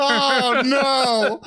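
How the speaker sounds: hammed-up oh no; exasperated outburst. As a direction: protest in mock horror.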